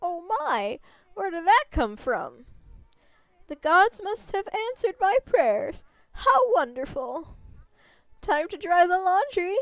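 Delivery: lightly voiced kindly village grandma; a gentle old lady warning the traveler. Old grandma greeting the wolf and its rider, lightly voiced.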